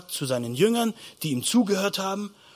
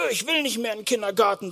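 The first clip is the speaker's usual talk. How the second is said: put-on higher, quick child's whine; reluctant kindergarten child; 4 semitones higher, 4 dB quieter, faster than usual. Preacher as child scared of cheeky Franz, whining reluctance. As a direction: play a little kid refusing, high and hurried. Voices child afraid of being hit.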